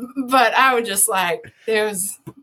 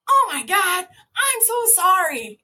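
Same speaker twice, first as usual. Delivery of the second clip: embarrassed apology; 10 semitones higher, slower than usual. Retold or imitated. Apologizing to guests.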